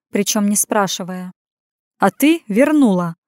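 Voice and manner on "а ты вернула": louder, lightly taunting comeback; mocking retort. A narrator's reading. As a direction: snap back with a jibe. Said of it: "огрызнулся я с издёвкой".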